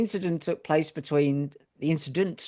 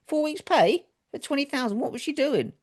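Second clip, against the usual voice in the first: Four weeks' pay for twenty thousand pitched far higher in disbelief; incredulous; 11 semitones higher than usual. Real, not acted. Reading the award figure, high disbelieving question.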